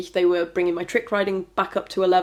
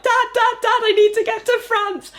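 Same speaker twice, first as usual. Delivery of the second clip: very high, loud urgent calls to dad; calling dad; 4 dB louder than usual. Retold or imitated. Running home to her dad, high and loud with excitement. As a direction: burst in shouting for dad.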